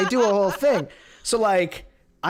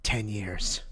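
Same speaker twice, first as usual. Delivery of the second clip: hushed awe; 8 semitones lower, 6 dB quieter than usual. Acted. Lets the boast sink in.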